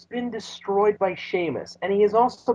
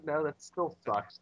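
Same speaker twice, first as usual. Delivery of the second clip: usual speaking voice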